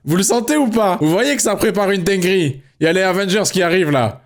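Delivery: high, hyped-up mock build-up; hyped teasing. Real, not acted. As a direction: tease the chat like the big moment is coming. Teasing chat about missed transition.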